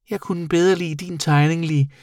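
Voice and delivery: high little creature voice; confiding bat. A narrator's reading. Bat secretly praising the boy's drawing, confiding high voice. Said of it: "hviskede Senior til Eddie".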